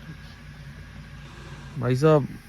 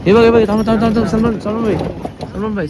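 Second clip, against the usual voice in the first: excited calling; 7 semitones higher, 4 dB louder, faster than usual. Real, not acted. Excitedly spotting animal on safari.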